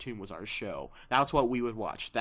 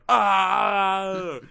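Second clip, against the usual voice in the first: high loud cry of dismay; imitated shriek; 8 semitones higher, 11 dB louder than usual. Cousin shrieking at the spoiler photo, high and loud. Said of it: "my cousin going".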